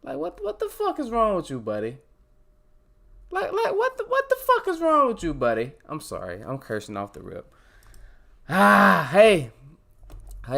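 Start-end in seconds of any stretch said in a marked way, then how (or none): 0.0-2.0 exasperated at himself. Real, not acted. Scolding himself after closing tabs. 3.3-5.7 much higher, louder incredulous outburst; self-directed mock rage, cracking high; exasperated yell at himself. Real, not acted. Repeats scolding louder, higher. 8.5-9.5 loud hey. Real, not acted. Loud greeting back to chat.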